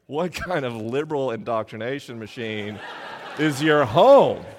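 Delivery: hammed-up scandalised guests; mock outrage. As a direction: friends visiting mock shocked at the house. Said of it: "And they were like".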